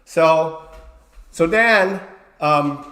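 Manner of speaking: ordinary talk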